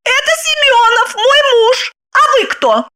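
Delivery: high, heated woman's voice; shouting wife. A narrator's reading. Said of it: "закричала Загогулина". (криком, высоким голосом)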